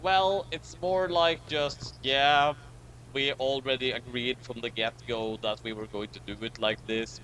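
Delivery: ordinary talk, nothing marked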